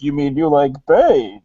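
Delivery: swooping, theatrical villain voice; Bane voice. Retold or imitated. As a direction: show how the masked villain should really sound. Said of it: "he tried to do a Bane voice".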